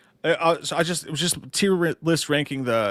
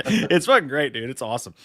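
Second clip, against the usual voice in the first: ordinary talk, nothing marked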